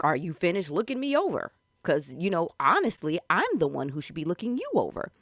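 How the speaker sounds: loud, haughty put-down; condescending. Retold or imitated. Patrick to the woman looking him over, condescending. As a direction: talk down to her as the one in charge. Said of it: "this very condescending voice".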